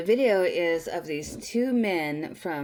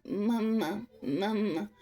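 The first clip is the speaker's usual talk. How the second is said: small, higher mock baby voice; imitated crying baby; 4 semitones higher, slower than usual. Baby wants its mama in the video, imitated crying voice. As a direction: copy the baby's call without real crying. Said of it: "starts crying even more and saying".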